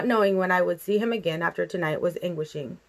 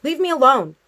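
higher, louder acted outburst; tearful scream; 7 semitones higher, 7 dB louder than usual. A narrator's reading. Girl rebuffing her father through tears, screaming. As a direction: scream it in mild form, no real crying. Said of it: "I screamed at him through my tears".

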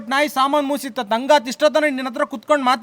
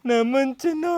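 soft, drawn-out whimper; plaintive whimper; 4 dB quieter, much slower than usual. Acted. Abandoned husband calling for his darling, soft whimper. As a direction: moan the pet name softly.

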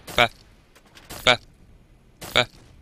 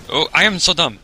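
loud exasperated cry; 6 semitones higher, 9 dB louder than usual. Real, not acted. Shouting at own mistake.

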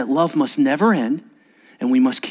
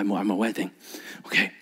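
normal talking voice

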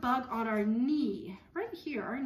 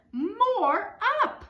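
higher and louder, eager; excited child; 11 semitones higher, 9 dB louder than usual. Acted. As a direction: call out like an eager little girl. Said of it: "she says".

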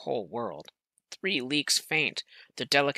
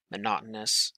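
usual speaking voice

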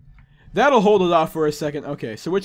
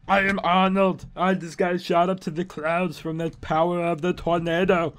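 put-on Austrian action-hero voice; Arnold Schwarzenegger. Arnold shot up to the clouds by tornado, Austrian impression. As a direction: declare it in a hammed-up Austrian accent. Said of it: "Am I still Arnold Schwarzenegger".

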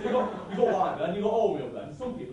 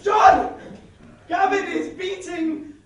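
loud, high, drawn-out yell; frantic yelling; 8 semitones higher, 10 dB louder, slower than usual. Acted. Linda shouting across the road to John.

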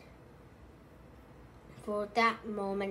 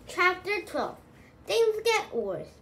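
high, loud chapter title; dramatic announcement; 9 semitones higher, 7 dB louder than usual. A narrator's reading.

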